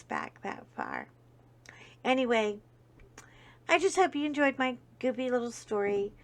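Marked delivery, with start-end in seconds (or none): 0.0-1.1 a secret aside, breathless; whispered aside. Real, not acted. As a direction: whisper the explanation. Unvoiced aside.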